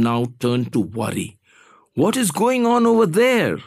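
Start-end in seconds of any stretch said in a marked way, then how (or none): 1.9-3.6 high-pitched, worried demand. A narrator's reading. Worried boss demanding answers from child, insistent. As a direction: demand to know, pitch soaring. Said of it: "he demanded".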